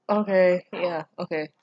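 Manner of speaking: normal talking voice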